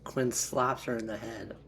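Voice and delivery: usual speaking voice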